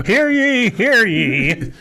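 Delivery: high, drawn-out playful proclamation; mock town crier. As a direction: call it out like a hammed-up herald. Mock proclamation to tease the speaker.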